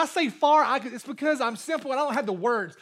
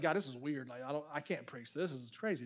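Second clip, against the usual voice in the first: much softer, lower aside; muttered bewilderment; 10 semitones lower, 14 dB quieter than usual. Retold or imitated. Preacher recalling his own reaction to the reading, muttered low. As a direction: mutter it under your breath, low and baffled.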